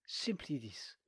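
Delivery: whispered aside; hushed confiding whisper. Acted. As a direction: drop to a secretive whisper. Old soldier's aside.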